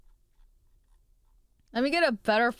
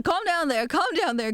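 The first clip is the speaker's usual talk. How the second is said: higher calm down; 7 semitones higher than usual. Shown as a demonstration. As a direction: tease Calm down there up high. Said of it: "Oh, hold on".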